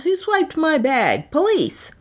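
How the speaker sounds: high, alarmed call for help; yelling for police. Acted. Mallory calls for police after his bag is stolen, high alarmed yell. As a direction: cry out for the police, pitch jumping up. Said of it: "I yelled".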